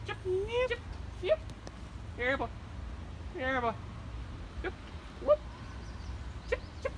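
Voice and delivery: high, sing-song yep calls; calling a lost pet. Acted. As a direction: call a squirrel like a dog. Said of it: "Call him".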